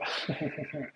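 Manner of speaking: brief laugh to oneself; chuckle. Real, not acted. Reader amused by own uncomfortable close-up, quiet chuckle.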